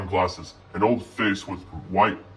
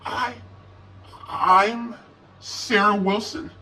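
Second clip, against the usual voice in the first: high, halting frightened voice; struggling; 12 semitones higher, slower than usual. A narrator's reading. Sarah answering the stranger, high halting and frightened. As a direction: force the name out slowly, scared. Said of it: "She struggled to say something".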